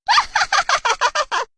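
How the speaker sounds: loud, very high witch cackle; staccato peal of wicked laughter; evil cackle. Acted. The witch appears in the classroom, loud evil cackle.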